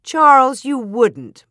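loud, high, scandalized protest; shocked outburst, falling from a high start; exclaiming. A narrator's reading. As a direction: cry out the name in shock. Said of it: "Ma exclaimed".

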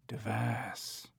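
soft copy of an ape's cough; imitated ape sound. A narrator's reading. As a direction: echo the coughed word very softly. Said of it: "Hager repeated the sound back".

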